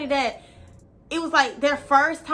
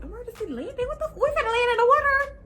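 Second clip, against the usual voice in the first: shocked disbelief; 7 semitones higher, faster than usual. Retold or imitated. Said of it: "So I'm like".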